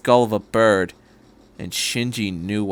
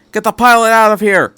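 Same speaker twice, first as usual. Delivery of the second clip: higher, louder urgent order; barked command; 10 semitones higher, 10 dB louder than usual. A narrator's reading. Miss Miyamura orders the pilot taken away, loud command. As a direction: order them out now. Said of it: "she said".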